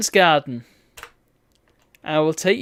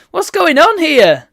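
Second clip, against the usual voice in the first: high, loud, slow surprised outburst; 10 semitones higher, 10 dB louder, slower than usual. Real, not acted. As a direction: blurt it out, baffled and loud. Surprised by game scene.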